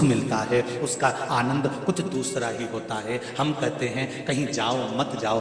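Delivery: low, soft, confiding counsel; hushed preaching. Real, not acted. Preacher urging children to sit with old parents, hushed. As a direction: drop right down, quiet and grave.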